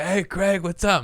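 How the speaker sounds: high-pitched, eager hail to a buddy; imitated buddy's cheery hello; excited greeting. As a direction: call out to a long-lost friend. Buddy greets him across crowded bar.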